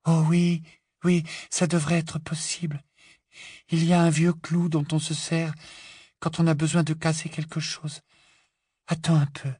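put-on high, even, dreamy girl's voice; Luna, placid and helpful. A narrator's reading. Luna offering to cut the ropes, placid dreaminess. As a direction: offer help serenely, up high.

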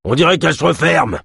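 high, urgent cry; alarmed exclamation. A narrator's reading. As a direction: call out the danger, pitch leaping. Kolb's roared alarm continues.

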